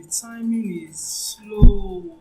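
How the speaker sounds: high, slow, drawn-out words; slow timing. Shown as a demonstration. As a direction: stretch each word out like someone who takes their time. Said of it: "some people take their time".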